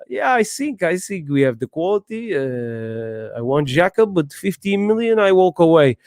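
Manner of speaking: a hint of a Portuguese accent, smug; a knockoff Portuguese manager, lightly done; a loosely accented mock Mourinho; cheap Mourinho impression. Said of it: "Man said Mourinho from Wish".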